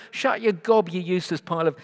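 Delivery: heckler's scornful outburst; jeering crowd. Acted. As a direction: shout down a nuisance from the crowd. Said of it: "Do you hear the crowd?".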